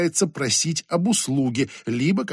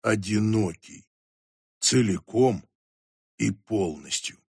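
lower, slow and dark; deep low man voice; 6 semitones lower, 5 dB quieter than usual. Acted. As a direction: speak low and heavy. Mint Fresh's voice.

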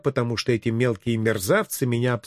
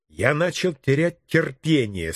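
ordinary delivery, nothing marked

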